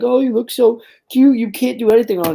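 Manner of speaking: high, cooing voice mimicking fans; mock cutesy admirer. Mimicking people who call him cute, high and cooing. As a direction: coo it up high like gushing over a puppy. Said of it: "people are like".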